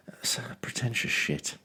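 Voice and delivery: slow, soft whisper; hushed, drawn-out aside. Acted. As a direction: whisper the insult under your breath. Muttered verdict on the art.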